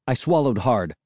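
ordinary delivery

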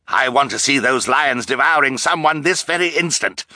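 commanding roar. A narrator's reading. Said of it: "commanded in a terrible roar".

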